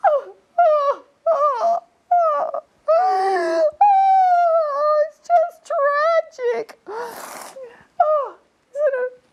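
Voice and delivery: very high, loud theatrical wailing; hammed-up sobbing. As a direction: wail over the tragedy like a bad soap star. Hammed-up weeping over fan's tragedy.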